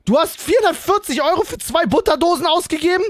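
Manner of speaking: high, loud indignant shouting; outraged. Real, not acted. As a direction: call out the wasted money. Friend overspends money.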